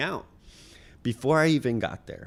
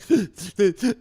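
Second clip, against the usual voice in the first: sharp sobbing gasp, much higher and louder; 12 semitones higher, 8 dB louder than usual. Retold or imitated. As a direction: gulp air like a crying child.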